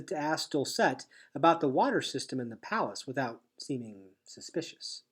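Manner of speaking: plain reading voice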